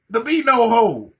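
ordinary delivery

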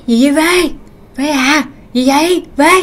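short, worried acted calls; alarmed calling out. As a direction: call to a brother who won't answer. Brother anxiously calls startled sibling.

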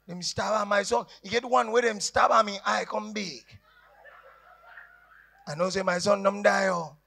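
usual speaking voice

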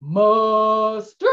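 loud rising call back to the captain; crew response. Shown as a demonstration. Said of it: "you guys respond saying".

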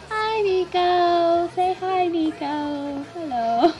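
a light put-on high character greeting; half-hearted try at Nico's voice. Voicing the doll Nico to the viewers, lightly put on. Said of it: "I can't do this voice".

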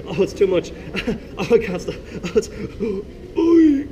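hammed-up helpless laughter, high; laughing king. King laughing at the jester's joke, high and breathless. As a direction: gasp through a mock laughing fit. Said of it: "the king laughed so hard".

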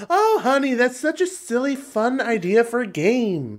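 high, singsong mock mom voice; indulgent mom. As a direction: humour the child, drawing out the end. Mom humouring child's wild story.

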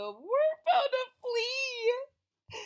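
high, slow, wondering; squeaky worm voice. A narrator's reading. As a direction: draw it out in a tiny high voice.

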